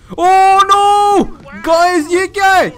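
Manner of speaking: high, loud startled shriek; real fright yell; frightened scream. Real, not acted. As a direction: scream at the jump scare. Ghost jumps out.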